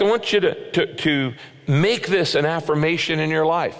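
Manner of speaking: ordinary delivery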